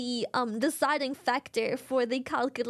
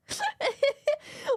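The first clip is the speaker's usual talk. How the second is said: high laugh; 12 semitones higher than usual. Real, not acted. Laughing at snail joke.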